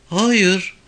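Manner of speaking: put-on high girl's voice; young girl. A narrator's reading. Girl answers police questioning, high young voice.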